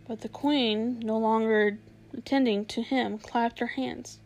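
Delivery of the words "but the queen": usual speaking voice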